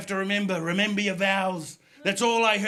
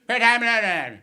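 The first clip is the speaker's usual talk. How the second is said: loud, slurred-together Belfast bark; garbled Northern Irish cabbie question; unintelligible Northern Irish accent; 5 dB louder, much slower than usual. Retold or imitated. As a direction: run the words into one Belfast blur. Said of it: "difficult to understand the Northern Irish accent".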